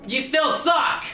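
high-pitched mock commander rallying troops; commanding harangue. Player ordering fellas to complete this level, commanding harangue. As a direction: bellow orders at a useless squad.

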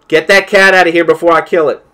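loud, high angry yell; exasperated shout. Real, not acted. To someone off camera about the cat, a loud angry shout. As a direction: bark the order across the room.

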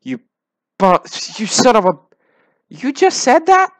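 stammering, then a very high, loud yell of disbelief; screeching outrage; outraged shout. Real, not acted. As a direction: blow up in shocked fury. Outraged at the diss line.

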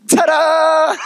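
high, loud showman's ta-da. Shown as a demonstration. Preacher shows how Jesus reveals himself, showman ta-da. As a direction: reveal it like a magician. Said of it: "Jesus just goes like this".

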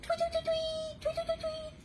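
very high chirping tweets; high birdy tweet-tweet; bird tweets. Acted. Imitating a lark singing.

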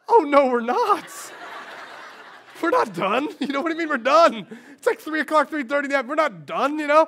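high, loud laughing protest; hammed-up refusal to leave the park; playful outraged insistence; mock protest. Pastor insisting they stay at Disney.

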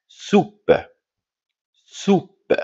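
"Soupe" high, ending on the p sound; silent final E. Shown as a demonstration. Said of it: "la lettre E ne se prononce pas". (« soupe » articulé, e final muet)

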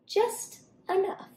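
a sly, scowling answer; mild scowl; scowling monkey. Acted. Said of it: "the monkey scowled".

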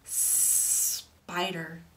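SPIDER with a long S held before the rest; long snake sound. Shown as a demonstration. Teacher repeats the word for children, long S. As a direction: hiss the start of spider at length. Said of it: "let's say spider again".

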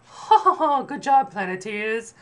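very high, loud, slow put-on laugh and praise; hammed-up cartoon spirit congratulating her team; spirit woman. Cartoon spirit woman merrily praising the Planeteers after an attack. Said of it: "the spirit woman's like".

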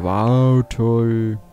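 ordinary talk, nothing marked

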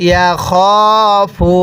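"ইয়া খ ফু" with a long held খ; drawn-out vowel. Shown as a demonstration. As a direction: stretch the খ in "ইয়া খ ফু". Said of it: "এরকমভাবে শিখব".

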